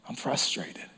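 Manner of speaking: unvoiced whisper, almost gone. Preacher voicing frustration to God, whispered. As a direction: let it fade into a whisper.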